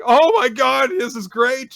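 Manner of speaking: high, loud gushing delight; acted squeal of delight; overjoyed. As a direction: gush with mock bridal joy. Delighted bride reacts to the plea.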